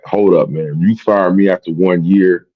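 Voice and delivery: ordinary talk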